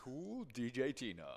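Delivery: playful DJ shout-out; radio host voice. Acted. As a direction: say it like a radio jock on air. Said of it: "like a radio station host".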